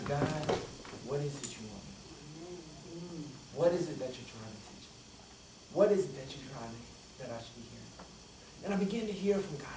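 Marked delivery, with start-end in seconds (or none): 0.0-7.9 lower, very soft plea; humble prayer. Retold or imitated. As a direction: ask it gently, almost whispering. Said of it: "turn our prayer to".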